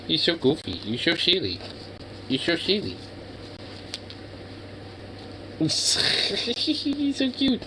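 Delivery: high, soft, cooing pet talk. Real, not acted. Streamer coos softly at his puppy, high baby talk. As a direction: coo at the puppy.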